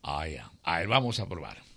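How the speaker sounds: low, quiet Death voice; Death's voice. Acted. Death sips the hot coffee, low and quiet. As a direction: keep Death low and hushed.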